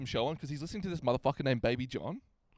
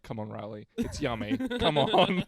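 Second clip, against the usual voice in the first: imitating a pleading coax that climbs higher; 5 semitones higher, 4 dB louder than usual. Kid pleading in his brother's face to eat, mock coaxing. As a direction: wheedle as if feeding a fussy eater. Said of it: "he actually is in his face".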